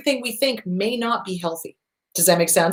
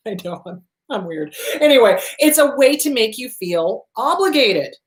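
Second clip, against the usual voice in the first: ordinary talk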